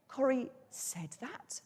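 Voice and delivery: soft, slow, rising question; doubting disbelief. Acted. Reply on hearing a friend criticised the talk, hurt disbelief. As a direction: stretch "said that" into a hushed, incredulous question. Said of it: "makes it sound like I doubt".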